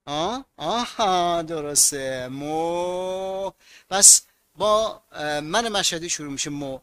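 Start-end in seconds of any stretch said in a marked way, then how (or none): 2.3-3.5 مو drawn out long, Mashhad style; stretched mu. Shown as a demonstration. Teacher to students, demoing a long drawn مو. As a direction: stretch the vowel of مو way out.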